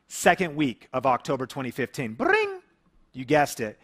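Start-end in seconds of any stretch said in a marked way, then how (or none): 2.1-2.6 high, punchy vocal ring; imitated phone ring. Telling of the second client's call, a mock phone ring.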